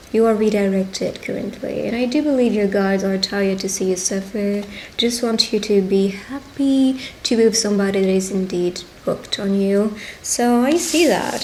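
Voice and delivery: usual speaking voice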